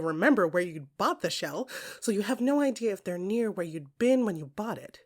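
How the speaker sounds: usual speaking voice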